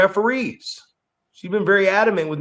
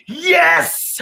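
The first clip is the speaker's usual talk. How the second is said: excited yell; 14 semitones higher, 4 dB louder than usual. Retold or imitated. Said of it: "with such enthusiasm".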